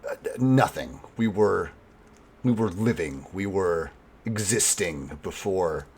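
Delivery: low, very soft, slow and halting; subdued captive. Acted. Captive elf priestess answering interrogation, subdued and halting. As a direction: answer quietly, leaving gaps between phrases.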